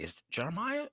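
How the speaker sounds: hammed-up high mom asking; mother asking whether he coached her son, in a high mock voice. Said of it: "the mom's like".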